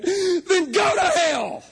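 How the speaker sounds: high, drawn-out tearful yell; mock wailing shout. Imitating a dumped boy cursing his girlfriend, mock wailing shout. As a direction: scream it slowly in a hammed-up wail. Dumped boy's retort, acted.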